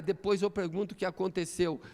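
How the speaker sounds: ordinary talk, nothing marked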